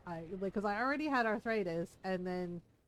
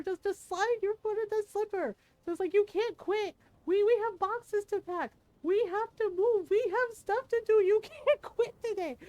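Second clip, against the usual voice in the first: high, loud mock-scolding of herself; yelling at myself; 10 semitones higher, 6 dB louder than usual. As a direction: push yourself through tears. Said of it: "yelling at myself".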